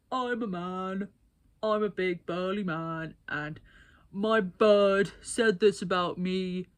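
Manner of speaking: slow, loud, put-on bloke voice; mock a big burly lad boasting; hammed-up macho bloke voice. Imitating stereotypical burly man.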